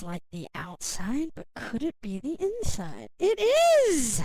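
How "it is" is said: high, loud announcing; playful reveal. Acted. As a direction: burst it out teasingly.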